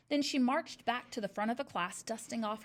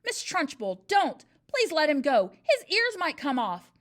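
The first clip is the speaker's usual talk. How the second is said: much louder, higher, alarmed plea; crying out in alarm; 6 semitones higher, 11 dB louder than usual. A narrator's reading. Miss Honey cries out from the back of the class in alarm. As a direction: call out to stop her. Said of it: "Miss Honey cried out".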